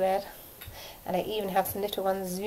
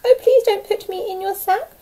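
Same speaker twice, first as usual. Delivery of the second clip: higher, louder squawky pleading; pleading duck; 9 semitones higher, 8 dB louder than usual. A narrator's reading. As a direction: beg like a frightened duck. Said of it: "the downy duck began to quack".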